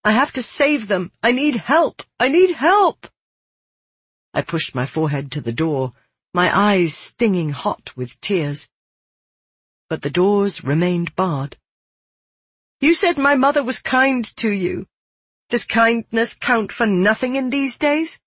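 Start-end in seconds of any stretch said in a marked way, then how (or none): 0.0-3.1 high, urgent cry building to a peak; desperate plea. Acted. Girl begs Milaka through the barred door, desperate. 12.8-18.2 high, pressing plea; urgent appeal. Acted. Girl reminds Milaka of her mother's kindness, pleading.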